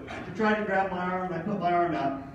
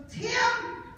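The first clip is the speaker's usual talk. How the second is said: high mock wife voice, firm pep talk; lightly voiced wife, encouraging; imitating wife; 8 semitones higher than usual. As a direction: put on his wife's higher voice to push him. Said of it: "She goes".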